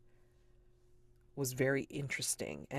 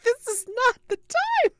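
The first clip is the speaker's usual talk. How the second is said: much higher and louder, fed-up rebuke; exasperated snap; 12 dB louder than usual. Retold or imitated. Fiancée cutting Victor off mid-crisis, high exasperated snap. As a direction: shut down the question sharply. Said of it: "I'm like".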